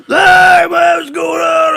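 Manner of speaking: put-on very high, loud drunken greeting; Frankie Rappa. Drunk racer walks over to greet friend, boisterous holler. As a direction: holler hello like an already-drunk buddy. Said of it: "You fucking drunk already?".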